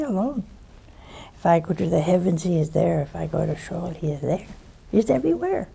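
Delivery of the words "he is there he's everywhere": normal talking voice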